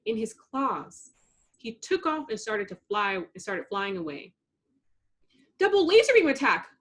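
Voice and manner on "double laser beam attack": high, loud, fast attack shout; battle call. A narrator's reading. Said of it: "Blossom called to Buttercup".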